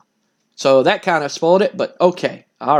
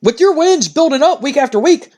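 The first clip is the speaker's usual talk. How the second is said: higher, keen interviewer voice; a reporter pushing his question, pitched up; eager interviewer; 7 semitones higher than usual. Retold or imitated. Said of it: "And Cole goes".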